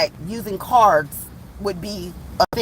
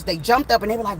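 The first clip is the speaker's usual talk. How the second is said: plain reading voice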